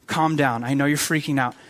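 low, soft and soothing; calming reassurance. Acted. God answering a freaking-out Moses, low calm reassurance. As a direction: settle down someone who is panicking, quietly. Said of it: "God is saying".

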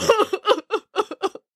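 high wet sob; tearful sob. A narrator's reading. Boris sobs over the duckling's story. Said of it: "wept Boris".

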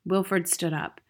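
usual speaking voice

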